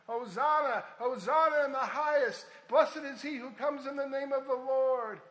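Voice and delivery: loud, high crowd acclaim; crowd calling out. Retold or imitated. Preacher voicing the crowd hailing Jesus, loud joyful calling.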